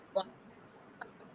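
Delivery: faint, far from the mic; phone held too far away; barely audible. Real, not acted. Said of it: "so your voice is, uh, very low".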